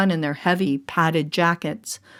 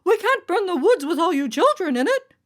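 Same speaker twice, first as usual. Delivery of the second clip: a much higher, urgent judge voice; judge calling; 10 semitones higher than usual. A narrator's reading. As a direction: plead with the children to come back. Judge pleading with fleeing children.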